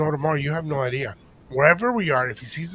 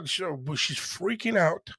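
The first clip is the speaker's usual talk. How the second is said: ordinary delivery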